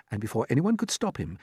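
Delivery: ordinary delivery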